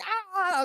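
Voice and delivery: very high cry of someone being hit; high comic "aah"; mock scream. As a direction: imitate yelping under blows.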